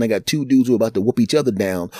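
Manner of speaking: normal talking voice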